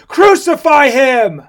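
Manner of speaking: slow, high, full-voiced mob shout; drawn-out, very loud demand; crying out loudly. A narrator's reading. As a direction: yell it with all the crowd's insistence. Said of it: "cried out the more exceedingly with loud voices".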